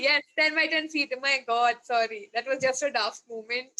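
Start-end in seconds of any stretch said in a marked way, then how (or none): none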